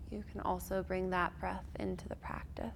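whispering. Real, not acted. Soft breath cue, trailing whisper.

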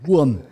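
Huren hit hard and loud, falling; punched Huren. Shown as a demonstration. Demonstrating insult stress to friend, punched. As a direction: spit out Huren. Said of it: "Also so wie du Hund".

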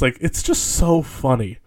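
ordinary talk

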